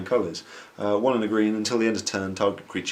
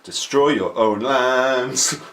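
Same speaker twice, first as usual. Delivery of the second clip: loud slow exclamation; 5 semitones higher, 9 dB louder, much slower than usual. Acted. Joking exaggerated exclamation.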